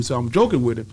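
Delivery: normal talking voice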